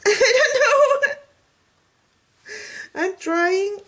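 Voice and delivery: very high, slow, giggling shrug; laughing. Real, not acted. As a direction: laugh the words out helplessly. Giggling, unsure about drying time.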